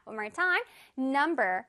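normal talking voice

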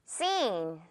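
"Scene" with a drawn-out ee; soft, slow SEEN for "Scene", the c silent; long vowel. Shown as a demonstration. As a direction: stretch "Scene" softly, voice falling. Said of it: "길게".

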